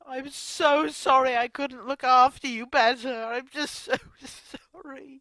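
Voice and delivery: a real, tearful plea for forgiveness; sobbing "sorry" over and over; sobbing apology. Real, not acted. Grieving carer apologises to the dead.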